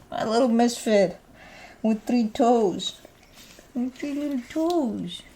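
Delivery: singled-out words stretched fondly; doting pet talk. Real, not acted. Doting baby talk to the dog.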